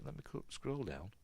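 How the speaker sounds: ordinary delivery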